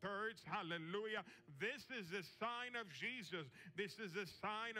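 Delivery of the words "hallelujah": ordinary talk, nothing marked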